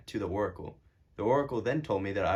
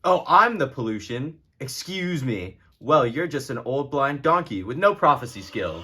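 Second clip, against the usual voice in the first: put-on louder, affronted retort to the old prophet; indignant outrage; 3 semitones higher, 7 dB louder, slower than usual. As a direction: scoff at him, then fling the insult hard. King insults the prophet.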